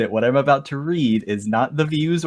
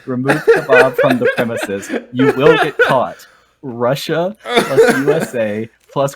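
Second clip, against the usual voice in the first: loud, laughing rant in broken English; hammed-up broken-English rant; 4 dB louder, slower than usual. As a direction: bellow the troll lyrics, laughing through them. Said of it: "the official lyrics".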